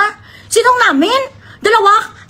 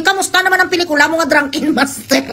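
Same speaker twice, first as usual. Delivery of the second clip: normal talking voice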